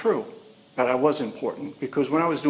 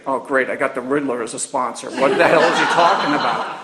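sarcastic laughing quip; 6 dB louder than usual. Retold or imitated. Sarcastic reaction to sponsor, laughing.

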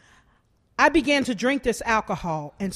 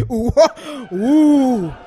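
knowing ooh; 5 dB louder than usual. Retold or imitated. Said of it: "everybody's like".

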